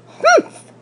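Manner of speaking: a sharp, high scoffing humph; a maid's dismissive humph, pitched high; scornful humph. A narrator's reading. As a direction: snort it out in disdain. Maid scoffs at the rabbit.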